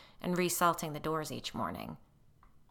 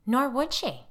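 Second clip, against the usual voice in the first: high loud curt retort; spat; 8 semitones higher, 9 dB louder, slower than usual. A narrator's reading. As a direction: spit it back in contempt. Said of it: "Molly spat".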